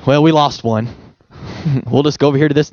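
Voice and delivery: lightly voiced enemy, low and then hurried; a hint of a dismissive devil; mock relieved enemy. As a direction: shrug it off like a bored villain. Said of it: "the enemy's not gonna be like".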